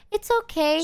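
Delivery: high, forgiving little voice; imitating meek friend. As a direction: mimic your friend brushing off an insult. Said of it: "she's like".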